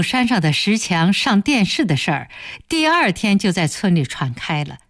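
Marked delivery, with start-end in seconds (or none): none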